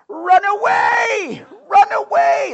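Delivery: high, drawn-out yell of fleeing knights; panicked retreat shout. Retold or imitated. Knights fleeing battle yelling in panic, high comic shout. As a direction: shriek it like a cowardly Monty Python knight. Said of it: "they'd always go out of the battle going".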